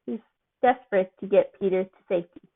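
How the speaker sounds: usual speaking voice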